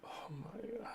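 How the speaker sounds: "Oh my God" breathed out high and soft, in disbelief; stunned dismay. Real, not acted. Streamer staring at a viewer's awful chart, aghast and hushed.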